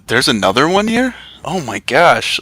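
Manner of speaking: rising incredulous question, then mock gasped-out realization; retold shock. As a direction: act out being shocked at a discovery. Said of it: "I was shocked to meet Ryan".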